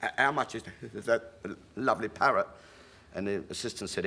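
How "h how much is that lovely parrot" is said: stammering on the first h of how; the writer asks the price, stuck; stuttering Johnny. Retold or imitated. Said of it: "he got this terrible stutter".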